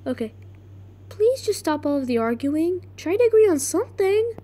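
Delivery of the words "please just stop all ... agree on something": higher-pitched plea to end a quarrel; plead, climbing higher toward the end; acted exasperated peacemaker; [name]. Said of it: "Okay, [name]".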